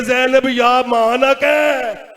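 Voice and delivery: loud, long-drawn wail; slow, full-voiced cry of grief; mourning wail. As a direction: stretch the words out in lament. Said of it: "मातम करके कहती है".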